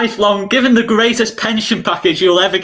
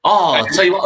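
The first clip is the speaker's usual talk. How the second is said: usual speaking voice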